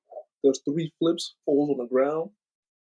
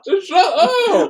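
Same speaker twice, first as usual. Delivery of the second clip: very high, loud, drawn-out yell; exasperated shout; 9 dB louder, much slower than usual. Real, not acted. Shouting over the other's laughter.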